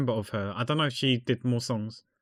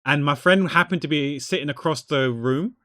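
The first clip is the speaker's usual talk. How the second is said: ordinary talk, nothing marked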